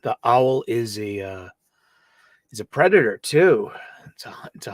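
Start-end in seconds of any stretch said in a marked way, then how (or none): 4.1-4.7 fast whispered aside; quick whisper. Real, not acted. Host whispering about owls hunting, quick whisper. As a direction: hush it like a secret.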